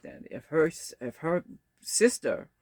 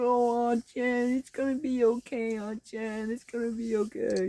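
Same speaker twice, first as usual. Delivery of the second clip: put-on singsong toddler reassurance, pitched way up; comforting toddler; 9 semitones higher than usual. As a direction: imitate a tiny nephew sweetly consoling his aunt. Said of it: "two-year-old nephew, he comes over".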